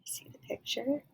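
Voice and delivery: soft unvoiced whisper; hushed aside to the listener. Real, not acted.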